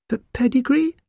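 very high, stammering little puppy; tiny puzzled creature voice; small puppy voice. A narrator's reading. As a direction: squeak it in bewilderment. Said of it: "in an extremely small voice".

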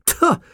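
high, scornful "tuh" snort; pug's high-pitched huff of contempt. A narrator's reading. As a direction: snort disdainfully in a high pug voice. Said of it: "a positive volley of snorts".

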